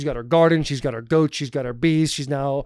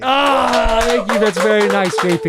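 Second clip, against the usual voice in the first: mock surprise; 7 semitones higher, 9 dB louder, slower than usual. Mock thanks for birthday applause.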